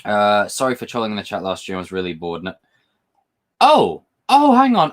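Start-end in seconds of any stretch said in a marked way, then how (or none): 3.6-4.9 high, loud startled outburst; sudden alarm. Real, not acted. Streamer catching himself mid-chat, real startled outburst. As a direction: jump in, catching yourself. Notices a mistake while reading chat.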